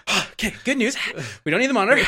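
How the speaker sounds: a milder replay of the smug sigh and the fake good news; sarcastic and patronizing. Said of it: "so sarcastic and patronizing".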